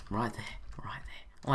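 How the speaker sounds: soft unvoiced whisper. Real, not acted. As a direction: hush it under your breath. Whispering during the game.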